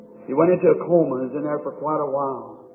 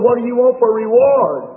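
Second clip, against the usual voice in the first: lifted, louder eager question; preacher voicing an eager heavenly greeter; 7 semitones higher, 6 dB louder than usual. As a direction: ask it bright and eager. Excited question in retold scene.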